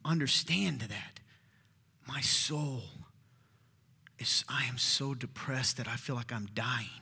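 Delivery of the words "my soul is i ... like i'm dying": quiet, halting anguish; depressed, scared plea. Acted. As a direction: whisper it as if worn down by sorrow. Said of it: "depressed. He is scared".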